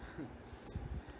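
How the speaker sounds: a quiet, breathy little laugh; soft melancholy laugh. A narrator's reading. Ricardo at the chapel, a soft melancholy laugh. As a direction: let out a hushed, wistful chuckle. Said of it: "sorriu melancólico".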